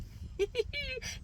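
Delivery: high, soft imitated giggle; stifled giggle. Mom giggling under her mask on the train, stifled. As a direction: giggle quietly, holding it in. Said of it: "I just started giggling under my mask".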